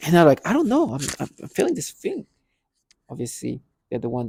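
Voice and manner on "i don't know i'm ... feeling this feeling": flustered reply. Retold or imitated. Own confused reply retold.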